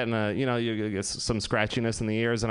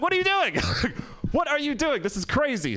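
shocked disbelief; 12 semitones higher than usual. Retold or imitated. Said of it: "It's like".

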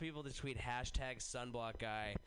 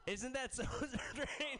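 laughing; 10 semitones higher than usual. Real, not acted. Laughing at own story.

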